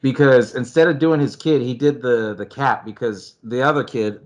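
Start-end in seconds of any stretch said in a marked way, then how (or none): none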